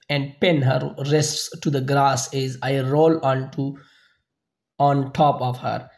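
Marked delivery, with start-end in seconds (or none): none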